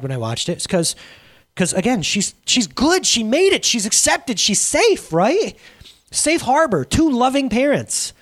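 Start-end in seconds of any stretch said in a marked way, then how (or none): 1.6-8.1 rising passion. Real, not acted. Emotional preaching about adopted girl.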